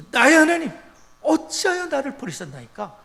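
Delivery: preacher voicing Jesus' loud cry; anguished outcry. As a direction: cry it out from the cross. Jesus crying out on the cross.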